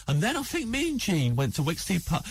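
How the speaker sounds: high, bright-eyed hostess offer, lightly done; chirpy mum voice. Retold or imitated. Comedian imitating a friend's mum offering breakfast, high sing-song. Mum rambling about Kettering.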